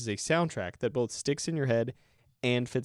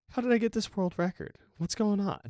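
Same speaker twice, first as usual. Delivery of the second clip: mock surprise; 10 semitones higher than usual. Mock puzzled question to listeners.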